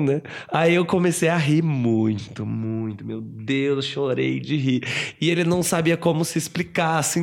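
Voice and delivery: ordinary delivery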